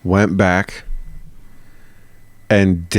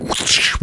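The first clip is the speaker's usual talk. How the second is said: unvoiced swishing noise; breath-only impact sound effect; imitated whoosh; 5 dB quieter than usual. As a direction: make the swish of a swung blow. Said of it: "Five Snap Charlie would be like".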